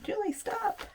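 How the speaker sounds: high squeaky mock viewer voice; viewer scolding. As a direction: play an exasperated viewer telling her to quit. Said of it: "You're probably th-, telling me that".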